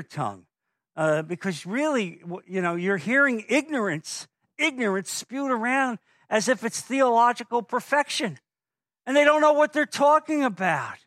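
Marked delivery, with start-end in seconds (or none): 2.7-11.0 preaching at full force. Acted. Pastor pressing the congregation on reconciliation, full-force preaching. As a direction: drive the word home to the congregation.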